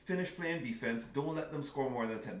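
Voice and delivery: normal talking voice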